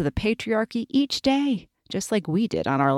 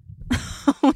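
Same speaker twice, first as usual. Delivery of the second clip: light laugh; 7 semitones higher than usual. A narrator's reading. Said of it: "You make me laugh".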